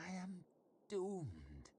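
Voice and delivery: gloomy, self-pitying murmur; disconsolate murmur. A narrator's reading. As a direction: mutter it like all is lost. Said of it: "he murmured disconsolately".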